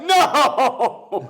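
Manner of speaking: long, high hammed-up wail; mock anguished cry. Edward as the old man dies, mock anguished cry. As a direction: cry out in fake despair, half laughing. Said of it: "And Edward's like".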